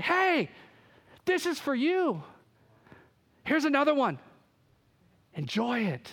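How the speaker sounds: giddy, eager giver offering another gift; giddy God. Acted. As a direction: hand out each gift like an excited kid. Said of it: "as he was getting giddy".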